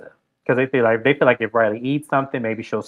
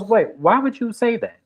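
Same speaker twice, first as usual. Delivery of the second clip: imitating confused Georgia; 9 semitones higher than usual. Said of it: "She goes".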